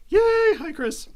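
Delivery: excited. Real, not acted. Greets a chat viewer.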